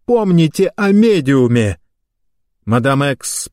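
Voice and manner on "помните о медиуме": high urgent warning; high, pressing cry; alarmed shout. A narrator's reading. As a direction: cry out a reminder, pitch raised. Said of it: "закричал он".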